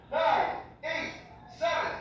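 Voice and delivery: normal talking voice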